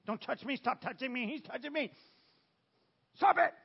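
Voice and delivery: put-on whiny high kid voices rising to a yell; childish whine building to a scream; squabbling kids. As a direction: play bickering siblings, ending in a shout. Preacher acting squabbling kids.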